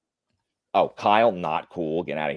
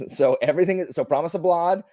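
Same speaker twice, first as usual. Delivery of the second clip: normal talking voice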